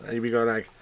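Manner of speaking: ordinary talk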